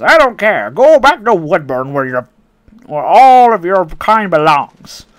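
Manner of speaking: loud, scornful mock old lady; hammed-up granny ordering him away; ranting old lady. Racist customer voiced in story.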